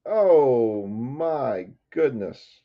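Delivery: very slow, drawn-out exclamation; awestruck. Real, not acted. Breaker marvelling at his big hit, slow awestruck swoop. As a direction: stretch each word in amazement.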